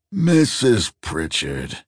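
put-on slow croaked old man; croaking old man. A narrator's reading. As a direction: drag it out like a frail old man. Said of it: "he croaked".

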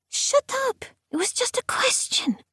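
very high, flustered squeal; squeaky protest. Acted. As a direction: squeal it back, embarrassed. Jean-Marie snaps back embarrassed.

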